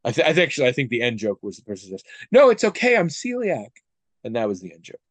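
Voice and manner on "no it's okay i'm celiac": high, loud, breezy and oblivious; cheery reassurance. Retold or imitated. Customer breezily reassures the pharmacist, high and cheerful. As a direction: toss it off brightly as if all is fine.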